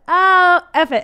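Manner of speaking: long loud high "Ah", then drop; exasperated little-kid outburst, imitated; toddler cursing. Son curses out of nowhere at the cheeses, mock exasperation.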